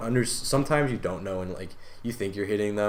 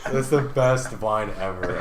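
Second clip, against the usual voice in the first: ordinary delivery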